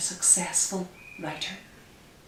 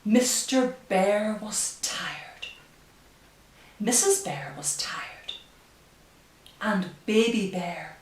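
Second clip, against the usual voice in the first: slow, hushed storybook reading; reading to children; 5 dB louder, slower than usual. Acted. Reads picture book aloud.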